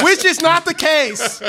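laughing shout over a friend; loud laughing yell. Real, not acted. Talking over his friend.

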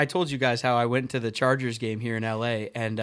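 normal talking voice

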